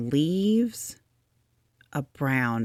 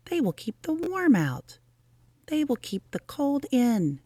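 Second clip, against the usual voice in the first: Rabbit explaining his plan in a higher voice; small proud Rabbit, pitched higher; higher Rabbit voice, pleased; 11 semitones higher than usual. Acted.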